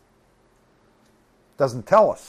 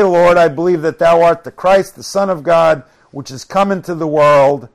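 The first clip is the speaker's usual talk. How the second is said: loud, raised preacher's reading voice; scripture proclamation; 4 semitones higher, 8 dB louder than usual. Acted. Preacher reads Jesus' resurrection words to congregation, loud proclamation. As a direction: declare the verses with conviction.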